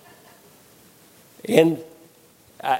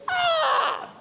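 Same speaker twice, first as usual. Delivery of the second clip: sudden high yelp of pain; pained scream; 5 dB quieter than usual. Retold or imitated. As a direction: cry out as the BB hits. Brother shot with BB.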